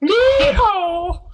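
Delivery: cowboy yeehaw. Pretend. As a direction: holler like a rodeo cowboy. Mocking the redneck marksman joke.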